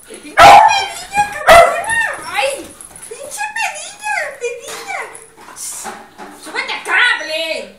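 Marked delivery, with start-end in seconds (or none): 0.1-2.0 loud, high cursing at a dog; cursing shout. Real, not acted. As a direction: yell insults at the dog. (insultos a gritos)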